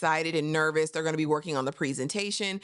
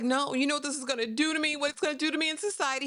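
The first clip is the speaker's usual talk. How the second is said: high, fast imitation of a panicked wife; acted frantic pleading; 8 semitones higher, faster than usual. Wife begs husband about her standing in society, mock frantic pleading. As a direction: plead in a rushed, raised pitch.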